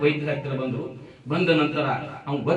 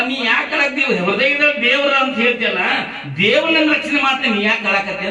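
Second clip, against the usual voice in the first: high, loud acted child's plea; impassioned questioning; 10 semitones higher, 8 dB louder than usual. Child asks weeping mother why she cries, impassioned. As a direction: press the question with raised pitch.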